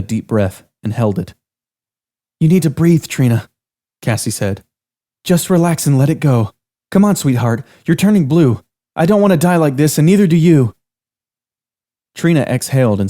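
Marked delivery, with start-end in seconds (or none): 2.4-3.5 higher, louder firm coaxing; urgent coaxing. Acted. Cassie calms friend. 5.2-10.7 higher, louder anxious soothing; pleading comfort. Acted. Cassie calms friend.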